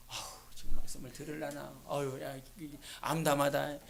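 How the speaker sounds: hushed despairing sigh. Retold or imitated. Quoting despairing thought.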